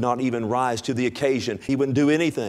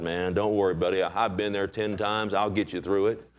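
lower, easy-going pal who's been there; reassuring drinking buddy; 6 semitones lower, 3 dB quieter than usual. Retold or imitated. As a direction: play the bad buddy offering help, voice dropped low. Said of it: "like your old lost friends".

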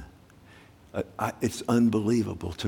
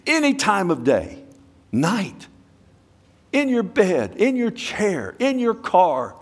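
higher, building fervent call; impassioned preaching; 9 semitones higher than usual. Acted. Preacher urging the congregation to come anytime, impassioned. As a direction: press the invitation home passionately.

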